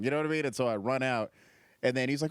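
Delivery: usual speaking voice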